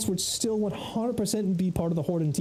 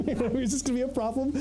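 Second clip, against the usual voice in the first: mock worried, laughing; 5 semitones higher, faster than usual.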